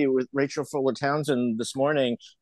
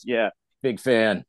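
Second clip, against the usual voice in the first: low, slow dry echo; deadpan sarcasm; 5 semitones lower, slower than usual. Retold or imitated. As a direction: flatly toss the fan's words back.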